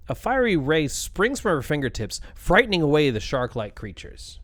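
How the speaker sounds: ordinary talk, nothing marked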